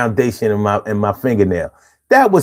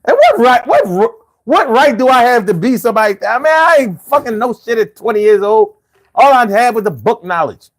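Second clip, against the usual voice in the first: incredulous self-reproach; 9 semitones higher than usual. Real, not acted.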